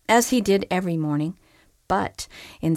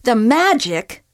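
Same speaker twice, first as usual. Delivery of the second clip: slow, sputtering disbelief, higher and louder; 5 semitones higher, 7 dB louder, slower than usual. Acted. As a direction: splutter it back in outrage. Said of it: "she sputtered".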